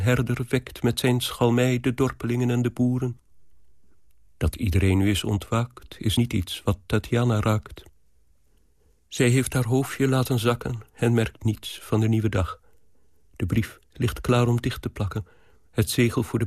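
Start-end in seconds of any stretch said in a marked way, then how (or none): none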